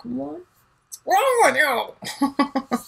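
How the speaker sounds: slow, drawn-out exasperation; slow teasing disbelief; exasperated mock question. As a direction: stretch it out at a frustrating friend. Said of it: "I'm like".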